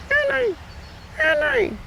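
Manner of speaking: very high, soft far-away call; distant yelling. Acted. Boy thinks he hears Mom calling, faint high distant yell. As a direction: mimic Mom calling thinly from a long way off. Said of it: "And he's yelling".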